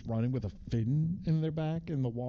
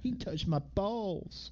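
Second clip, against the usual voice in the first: mock kid voice; 6 semitones higher, 5 dB quieter, much slower than usual. Mock voicing kid in movie.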